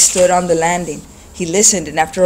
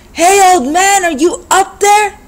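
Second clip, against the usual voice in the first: much higher, loud call; 13 semitones higher, 8 dB louder, slower than usual. A narrator's reading. Thug calling up the stairs to the old man, loud. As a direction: yell up the stairwell.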